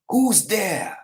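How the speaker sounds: high, sharp guard's cry; startled challenge. Retold or imitated. Startled guard calling out to the hidden archer, high. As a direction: demand who's there in alarm.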